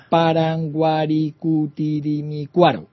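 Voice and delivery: Paranguarikutirimicuaro read out slowly, syllable by syllable; reading aloud haltingly. Shown as a demonstration. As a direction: spell out Paranguarikutirimicuaro, slow and even. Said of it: "lo voy a leer despacito".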